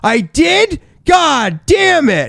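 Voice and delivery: high, drawn-out exasperated cry; mad at himself. Retold or imitated. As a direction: replay dismayed fury at your own mistake. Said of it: "I was so mad at myself".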